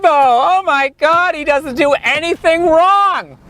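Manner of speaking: high, slow swooning fan; gushing fan. Retold or imitated. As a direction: swoon over Tebow like a devoted fan. Mocking Tebow fans.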